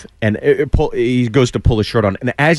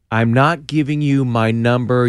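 slow, deliberate retold refusal; emphatic refusal. Girl refusing the man one last time, emphatic. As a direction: spell the refusal out slowly.

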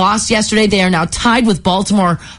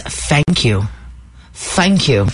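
"Thank you" with a crisp British th; 4 semitones lower, much slower than usual. Retold or imitated. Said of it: "She says".

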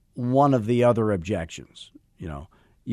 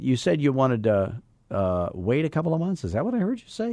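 incredulous question voice; 2 semitones higher than usual. Shown as a demonstration. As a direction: ask as if you misheard something crazy. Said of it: "question voice".

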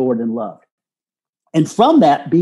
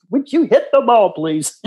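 laughing mock plea; 8 semitones higher than usual. Mock quote of meeting wife.

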